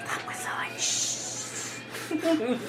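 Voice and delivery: urgent hushed whisper. Acted. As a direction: hiss it so nobody hears. Hushing while sneaking.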